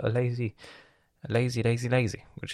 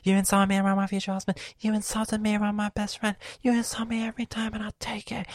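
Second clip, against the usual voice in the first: much higher whiny mimic, last line whispered; mocking sister; 13 semitones higher, faster than usual. Imitating his sister's complaint at him, mocking whine. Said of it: "she starts talking with me".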